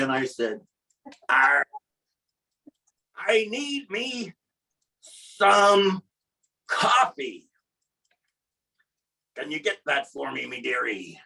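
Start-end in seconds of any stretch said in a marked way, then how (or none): 1.3-11.2 slow, drawn-out pirate voice; pirate accent. Retold or imitated. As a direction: order coffee like a storybook pirate. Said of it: "I'm talking in a pirate accent".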